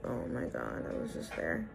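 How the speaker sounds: retold under-the-breath disbelief, whispered; dismayed whisper. Learning she drove past it, dismayed retold whisper.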